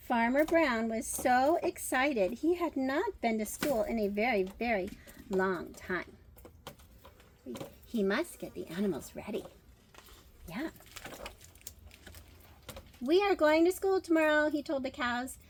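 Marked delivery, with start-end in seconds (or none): 0.1-9.4 story narration. Acted. Reads picture book aloud. 13.0-14.5 higher, louder eager call; excited announcement. Acted. Farmer telling the cows about school, excited and loud.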